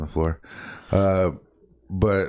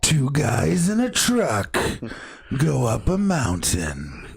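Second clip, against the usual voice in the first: slow, high-pitched trailer announcer; action movie voice; 10 semitones higher, slower than usual. Pretend. Host pitching a two-guys film, action movie voice. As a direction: stretch it out like a hammed-up film trailer. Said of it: "my action movie voice".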